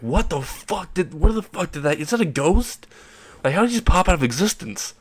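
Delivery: high, incredulous group reaction; baffled disbelief. Acted. Onlookers baffled by the vanishing.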